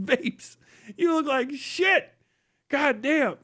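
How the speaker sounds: ordinary talk, nothing marked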